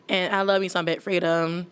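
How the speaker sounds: ordinary talk, nothing marked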